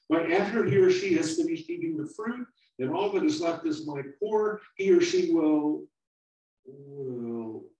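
put-on high, loud, worried young voice; a small fruit pleading not to fall; little apple, scared. Small apple begging the older one, high and loud.

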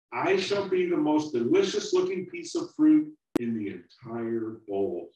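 put-on high, loud, worried young voice; a small fruit pleading not to fall; little apple, scared. Small apple begging the older one, high and loud.

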